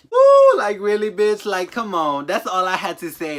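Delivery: laughing whoop into a loud rant; loud, high, incredulous yelling; loud outraged whoop. Real, not acted. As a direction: shout it out, half laughing in disbelief.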